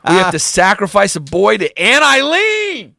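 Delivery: mock-dramatic shout climbing to a high squeal; exaggerated podcast voice. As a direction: ham it up loud, soaring on the last name. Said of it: "neither one of us talked like this".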